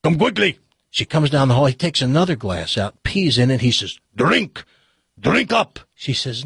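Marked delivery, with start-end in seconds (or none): 0.0-0.6 high-pitched call in a mock Russian accent; hammed-up Russian summons; Russian husband yelling. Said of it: "yells to his wife". 4.2-5.8 put-on higher, insistent Russian accent; comic Russian joke accent; mixed-up Russian accent. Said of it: "this Russian accent's gonna sound like".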